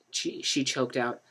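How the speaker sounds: ordinary delivery, nothing marked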